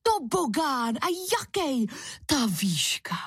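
high-pitched wonder, dropping on the last words; excited exclamation. A narrator's reading. Kuba spotting the towering water slide, excited exclamation. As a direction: marvel like a thrilled child. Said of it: "zvolal nadšeně Kuba".

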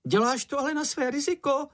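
very high-pitched shouted warning; anxious cry. A narrator's reading. Čenich warns Mumínek heading into the sea, high anxious cry. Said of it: "vykřikl Čenich".

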